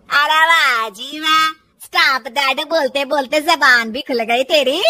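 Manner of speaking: slow, drawn-out mock praise; taunting, emphatic sarcasm; sarcastic mother-in-law. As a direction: stretch the words scornfully, ending on a rise. Mother-in-law mocks the back-talk.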